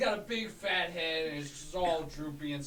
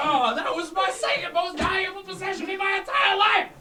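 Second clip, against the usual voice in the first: loud, higher, laughing grief; mock lament; 15 dB louder than usual. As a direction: overplay the loss. Seller laments lost name tag.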